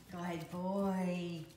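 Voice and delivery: slow, soothing dog praise; praising a dog. Real, not acted. Praising the dog after recall.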